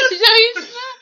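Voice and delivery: shouting through laughter; half-crying, half-laughing; laughing outburst. Real, not acted. Frustrated blooper, laughing. (crier en riant)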